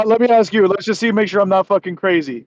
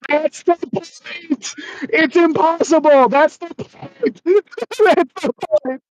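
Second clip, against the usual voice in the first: laughing; 7 semitones higher, slower than usual. Real, not acted. Laughing repeated exasperation.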